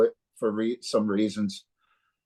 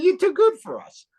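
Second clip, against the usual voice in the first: much higher and louder, laughing in disbelief; amazed praise; 6 dB louder than usual. Retold or imitated. Praising the young guitarist, much higher and louder in amazement. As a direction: gush that he's too good.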